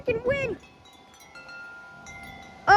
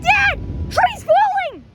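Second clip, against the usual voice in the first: high, loud, urgent warning; alarmed shout; 9 semitones higher, 4 dB louder, slower than usual. Real, not acted. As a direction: warn Dad in alarm. Warning dad about tree.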